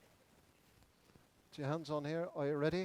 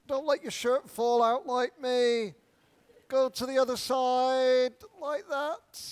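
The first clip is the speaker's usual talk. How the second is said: raised, loud instructor's call; calling out exercises; 7 semitones higher, 9 dB louder than usual. Real, not acted. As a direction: lead the whole room through stretches, projecting high.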